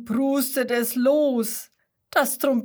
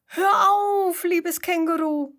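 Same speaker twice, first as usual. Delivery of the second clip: high, louder begging cry on hör auf; pleading birds; 7 semitones higher, 4 dB louder than usual. A narrator's reading. Birds begging the singing kangaroo to stop, high plea. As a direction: beg like little birds. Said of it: "Und die Vögel bitten".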